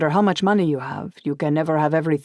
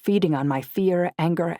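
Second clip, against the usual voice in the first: usual speaking voice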